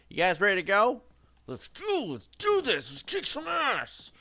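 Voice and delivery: high, excited shouting; hyped rallying cry. Pretend. Streamer rallies the team for a fight, hyped high shout. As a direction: fire up the squad, voice leaping high. Rallying teammates before game action.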